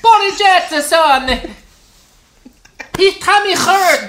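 high, loud laughing mock accent; mock South American mother, laughing; South American mom accent. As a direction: coo sympathy through laughter, thick accent. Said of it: "had a really thick accent".

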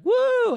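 loud, very high woo; mock supportive whoop. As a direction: let out a put-on cheering whoop. Acts himself cheering along.

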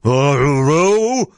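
loud monster voice swooping up; grumpy giant. A narrator's reading. Giant grumbling over his meal, grumpy giant. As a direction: call it out like a disgruntled giant. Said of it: "and this in grumpy tones".

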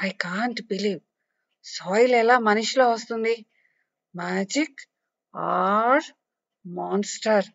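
soft whispered mutter; child mutters in disbelief about the soil woman; muttering to herself. Acted. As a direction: say it under your breath, wondering. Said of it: "అని మనసులో గొణుక్కుంటోంది".

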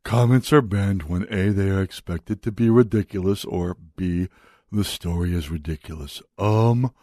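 slow, low, dim-witted impression with halting pauses; Nick Coleman. Radio host mocking a newspaper columnist, slow dopey impression. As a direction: drag it out like someone with a head injury. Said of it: "That's kinda how Nick Coleman sounds".

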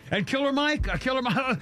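excited announcement. Real, not acted.

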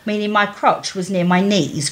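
normal talking voice

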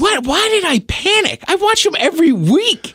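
high-pitched, incredulous self-questioning; exasperated self-reproach. Retold or imitated. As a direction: replay kicking yourself over a blunder. Berating himself for panicking.

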